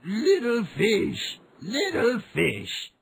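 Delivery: slow, high call over the water. A narrator's reading. Old man calls the fish at the sea again, slow and high. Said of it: "the man called out".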